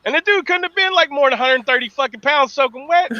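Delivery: usual speaking voice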